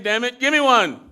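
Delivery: high, loud, laughing plea; a character's loud laughing demand; loud pleading character. Acted. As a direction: beg for it loudly, half laughing. Character begs for a name.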